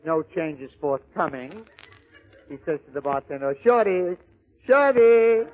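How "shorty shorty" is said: loud, higher drawn-out call; calling for attention. Acted. Customer awaiting change calls the bartender, loud insistent call. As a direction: call out insistently to get him over.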